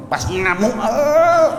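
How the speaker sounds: high, loud mock outburst; hammed-up tantrum noise; mock rage. Shown as a demonstration. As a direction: act out a fit of rage. Demonstrates a raging face and noise.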